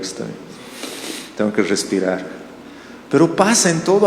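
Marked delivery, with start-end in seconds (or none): 0.0-2.2 much softer, calming himself down; self-calming murmur. Retold or imitated. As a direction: talk yourself down, barely voiced. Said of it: "le digo". (murmurando para calmarse, muy bajito)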